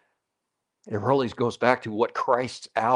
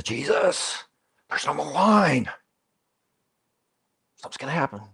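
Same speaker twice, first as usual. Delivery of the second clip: a touch of Mary's panic, pitched slightly up; half panicky; 3 semitones higher than usual. Retold or imitated. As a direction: tug his sleeve, worried and urgent. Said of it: "she's probably half panicky".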